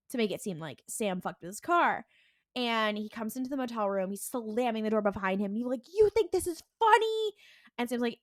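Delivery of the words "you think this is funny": high, loud accusing demand; hammed-up angry brother; mock angry. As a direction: confront him in a pitched-up, indignant voice. Dean confronts Sam about tires.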